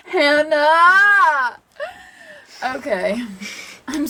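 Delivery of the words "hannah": high, loud drawn-out name; loud cry of protest. Real, not acted. Crying out during laughing game.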